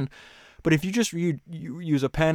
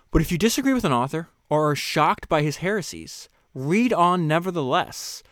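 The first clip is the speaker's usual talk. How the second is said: slow, louder, higher reading; slow, emphatic reading; 4 semitones higher, 5 dB louder than usual. Acted. Host rereading the passage to listeners, slow and emphatic. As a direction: stress each phrase, weighing it.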